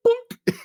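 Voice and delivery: high-pitched "boop" sound effect; imitated hit. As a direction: pop out a comic high bonk noise. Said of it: "runs by and goes".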